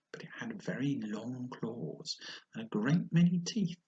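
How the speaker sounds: ordinary delivery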